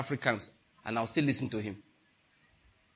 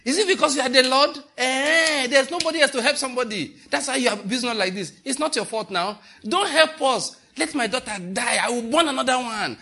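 loud, high mock outrage at the Lord; hammed-up shouting complaint; mock indignant shout; 10 semitones higher, 5 dB louder than usual. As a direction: rant like an offended petitioner, hammed up. Mocking offended woman, loud rant.

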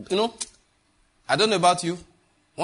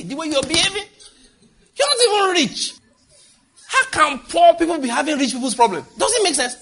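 much higher, exasperated disbelief; incredulous scolding; 13 semitones higher, 5 dB louder than usual. Real, not acted. Preacher scolding poor people with rich problems, high incredulous. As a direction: throw up your hands at them, pitched way up.

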